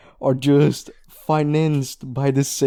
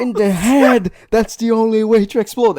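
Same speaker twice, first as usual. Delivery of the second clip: high, loud mock correction; exasperated acted shout; 8 semitones higher, 6 dB louder than usual. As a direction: shout it like an exasperated anime hero. Acting the exasperated character correcting her.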